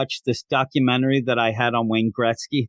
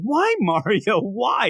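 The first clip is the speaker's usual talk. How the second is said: high, drawn-out wail of why; hammed-up betrayal, pleading upward; mock anguished plea; 7 semitones higher, much slower than usual. Host asks his imagined attacker why, mock anguish. Acted plea after being knifed.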